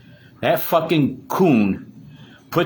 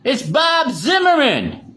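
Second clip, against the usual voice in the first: shouted reveal; 13 semitones higher, 3 dB louder, slower than usual. Real, not acted. Calling out [name]'s real name, shouting it high and slow. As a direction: yell the real name out for all to hear.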